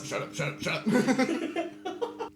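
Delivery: breathless laughing "shut up"; laughing disbelief. Real, not acted. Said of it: "laughing disbelief".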